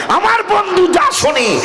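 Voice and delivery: high, drawn-out preacher's cry; soaring sermon shout; impassioned proclamation. Acted. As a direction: proclaim it slowly at a very high pitch. God's declaration repeated at full force.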